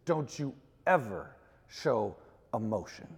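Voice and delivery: low, measured mild scolding; stern rebuke. Retold or imitated. Hard old teacher scolding the pastor after service, stern rebuke.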